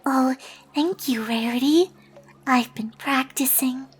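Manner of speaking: shy, soft little voice; very quiet. Acted. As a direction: barely speak up, timid and hushed. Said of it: "spoke up in a voice so quiet".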